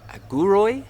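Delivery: guroi said high and rising, goo-ROY; name the animal guroi clearly for kids; Tarabalang word guroi. Shown as a demonstration. Teaching children the Tarabalang word, bright and clear. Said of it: "Can you say guroi?".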